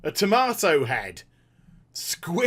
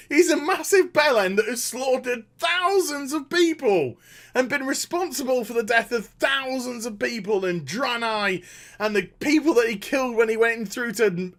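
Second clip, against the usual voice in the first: ordinary delivery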